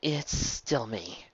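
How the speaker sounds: soft, very slow and gentle; a changed friend reassuring softly; slow soft reassurance. Acted. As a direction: ease her fears slowly. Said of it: "holding up her paw slowly".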